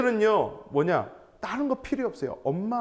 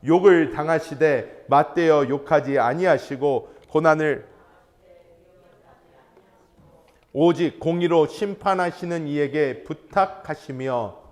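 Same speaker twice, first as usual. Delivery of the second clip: slow, louder, measured recitation of verse; scripture reading; 6 dB louder, slower than usual. Real, not acted. Preacher leading the congregation in reading scripture aloud slowly. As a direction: read the verse aloud, deliberate and solemn. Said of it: "함께 읽어봅니다".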